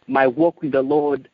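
usual speaking voice

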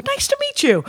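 very high sing-song nice to meet you; overly sweet polite greeting; chirpy hello. Retold or imitated. Meeting Michael Sheen, chirpy and very high. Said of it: "And I go".

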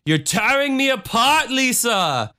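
high, strained mock anguish on "You're tearing me apart"; Tony Wiseau impression. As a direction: ham up a wounded lover's outburst. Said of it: "he'd get really upset and he'd be like".

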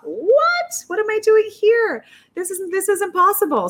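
high, loud mock-incredulous outburst; retold disbelief. Contestant to herself in the endless line, retold disbelief.

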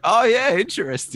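high, loud mock "oh yeah"; a sudden fake spark of interest; mock surprise. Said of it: "I was like".

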